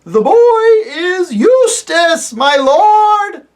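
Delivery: high, loud, slow owl shout; loud hoot. Acted. Owl shouting to the deaf dwarf, loud hoot. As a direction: hoot it as loud as you can. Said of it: "hooted the owl as loud as it could".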